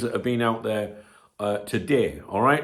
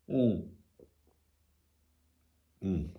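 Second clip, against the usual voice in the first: usual speaking voice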